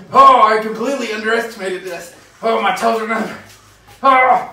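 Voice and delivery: high, loud, shaky from cold; shivering in ice water. Real, not acted. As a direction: talk through the freezing shock. Sitting in ice bath.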